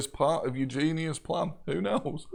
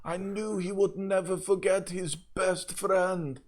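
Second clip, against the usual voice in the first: put-on higher, fervent French accent; 5 semitones higher than usual. Prisoner believing he is being rescued, fervent French accent. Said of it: "a French accent from another cage".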